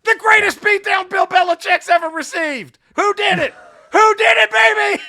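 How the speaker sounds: high, loud triumphant gloating; gloating shout. Real, not acted. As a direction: rub the win in his face. Gloating about football win.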